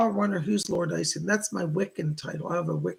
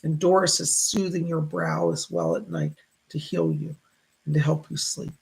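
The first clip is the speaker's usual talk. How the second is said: usual speaking voice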